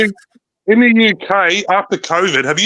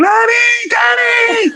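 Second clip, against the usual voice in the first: put-on high, slow, shouted child's cry for daddy; stretched-out shouted little kid's wail; imitating a screaming kid; 3 dB louder, much slower than usual. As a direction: do the kid yelling for his dad down the phone. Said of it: "I hear some kid screaming in the background".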